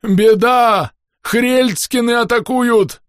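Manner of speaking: high slow alarmed yell. Acted. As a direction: scream the alarm. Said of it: "завопил старший носильщик". (тревожный вопль)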